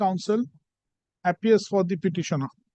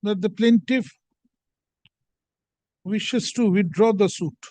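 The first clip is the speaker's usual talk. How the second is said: dictating an order slowly; slow dictation with punctuation; slower than usual. Real, not acted. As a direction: spell out the punctuation aloud.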